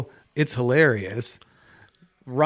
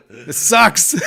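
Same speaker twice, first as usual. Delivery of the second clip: loud, higher, laughing complaint; comic lament; 6 semitones higher, 8 dB louder than usual. Retold or imitated. Doomed to live as Aquaman, comic lament. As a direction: blurt it out in mock misery. Mock lament of living underwater.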